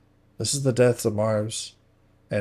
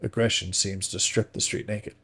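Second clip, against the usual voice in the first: plain reading voice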